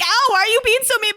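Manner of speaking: high, whiny mock complaint; a hint of a wounded man-child; mock hurt whine. Friend acting out Nick whining at the lady who hits him, high whiny mock hurt. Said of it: "He's like".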